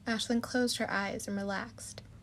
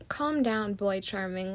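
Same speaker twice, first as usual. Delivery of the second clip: usual speaking voice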